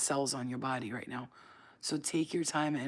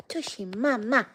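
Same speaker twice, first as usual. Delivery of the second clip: high, loud, drawn-out pet name; 6 semitones higher, 6 dB louder than usual. Real, not acted. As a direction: squeal a pet name slowly at your dog. Baby-talks her dog.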